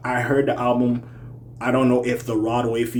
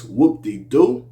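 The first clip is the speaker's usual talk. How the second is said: drawn-out rising tone; 6 dB louder, much slower than usual. Shown as a demonstration. Reviewer reading out a track title, Whoop-De-Doo drawn out and rising. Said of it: "I'll say it like that".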